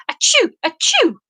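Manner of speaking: two high, loud sneezes; sneezing. Acted. Tag sneezing from the ice cream spell, loud sneezes. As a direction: sneeze hard, twice.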